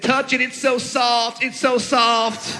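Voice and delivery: put-on high, drawn-out little-boy voice; imitated toddler. Three-year-old coaxing Dad, high slow put-on voice. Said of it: "He said".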